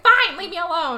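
high, loud acted huff; mock huffy outburst. Girl who just spanked her rival, mock huff. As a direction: snap it loudly, up high. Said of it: "she spanks her really hard".

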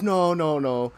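insistent retold protest; mock insistence. Sergeant cuts off the excuse.

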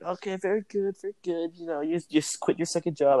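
teasing the boss lady who thinks he quit, high mock voice. Mimicking first boss lady.

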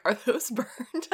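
laughing mock worry over the buns; imitating Chigs. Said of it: "Chigs was literally like".

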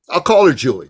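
ordinary talk

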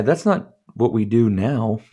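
ordinary talk, nothing marked

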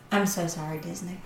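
ordinary talk, nothing marked

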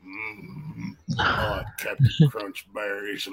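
low, slow, quiet grunting drawl; a bad Karl impression, slow and mumbled; horrible Sling Blade impression. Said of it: "I do a horrible impersonation".

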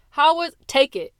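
sharp, loud and high, cutting her mother off; furious daughter interrupting; angry. Acted. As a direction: slam the words down in fury.

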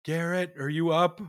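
retold yell. Wife calling to her husband as the baby fusses, mild retold yell. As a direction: call out like a wife from another room, half-strength. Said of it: "I hear my wife yell".

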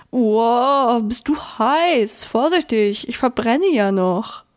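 odd creature voice, a startled wow; the lump flinching from the heat; surprised creature, then slow; rock lump voice. Acted.

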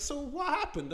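high, soft coaxing imitation; mock-friendly interrogator; good cop. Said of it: "I'll be good cop first".

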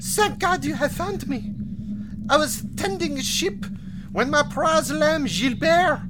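put-on high French girl accent, pleading; lost little girl, thick French accent; french chef. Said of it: "find a child weeping".

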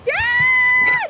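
high, shouted panicked call; robot crying for dad. Retold or imitated. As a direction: squeal it like a scared robot. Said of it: "You're just going".

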